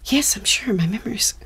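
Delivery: unvoiced whisper, flustered. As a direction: whisper back so the caller won't hear. Whispering back during call.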